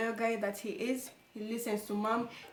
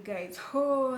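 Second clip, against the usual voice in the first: usual speaking voice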